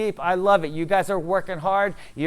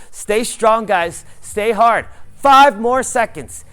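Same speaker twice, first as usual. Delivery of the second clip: loud, higher push through the burn; hype coach shout; 4 semitones higher, 9 dB louder than usual. Real, not acted. Coach driving the class through the last seconds, loud and higher. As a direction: drive them hard through the hold.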